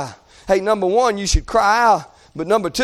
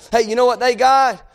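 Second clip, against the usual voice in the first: ordinary talk, nothing marked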